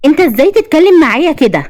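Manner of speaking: high, offended protest; affronted high-pitched woman; indignant rebuff. A narrator's reading. Hostess rebuffs flirting guest.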